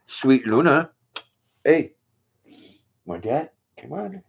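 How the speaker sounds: high sing-song coaxing voice to the dog. Real, not acted. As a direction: coax the dog to take a treat, voice lifted high.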